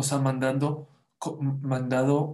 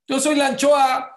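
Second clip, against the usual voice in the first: loud, high, hammed-up moan of a self-made victim; a comic loud pity-me cry; mock self-pity; 10 semitones higher, 8 dB louder than usual. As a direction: play the whiny victim, overdone. Mocking a self-pitying person.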